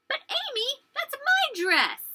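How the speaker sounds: high, drawn-out little-sister whine; indignant protest. Acted. Girl to her sister over a taken dress, indignant protest. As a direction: protest in a high, loud wail.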